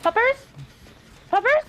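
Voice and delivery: high, cooing pet voice. Real, not acted. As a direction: coo at the puppy. Calling to a dog.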